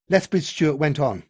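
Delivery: plain reading voice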